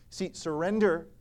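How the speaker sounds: ordinary talk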